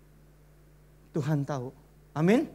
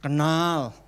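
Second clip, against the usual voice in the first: devil answering; 6 semitones lower than usual. Acted. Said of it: "Tuhan tanya".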